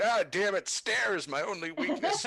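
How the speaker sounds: high, loud mock-dramatic old-man groan; hammed-up defeated old man; frustrated old man. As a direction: lament the stairs theatrically. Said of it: "you kinda hear from the outside".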